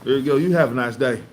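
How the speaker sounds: high, loud overly polite greetings; syrupy Southern hospitality; imitating a Southerner. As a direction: hurry them off sweetly. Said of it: "it's like".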